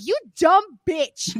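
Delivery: loud, slow angry yell of an insult; reliving a furious outburst at the screen; retold yell. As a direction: bark the insult at the TV, drawn out. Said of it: "I yelled".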